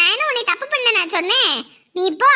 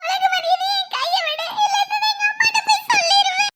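high, tearful wail; crying out, pitched way up; crying threat; 9 semitones higher than usual. Acted. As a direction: threaten him through tears. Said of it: "இப்ப எதுக்கு இப்படி அழுவுற".

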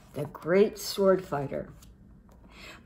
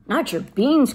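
higher, louder outraged cry; 5 semitones higher, 5 dB louder than usual. Acted. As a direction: protest in outrage. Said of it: "cried Scapito, outraged".

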